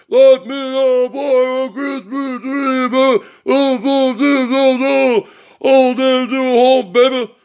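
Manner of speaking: slow crooning catchphrase with drawn-out oh's; mocking his rival's style. Vlogger mimicking how the rival talks, crooning mock style. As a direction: ham up the rival's showman patter. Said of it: "talks like, I came up with this style of talk".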